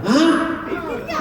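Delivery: normal talking voice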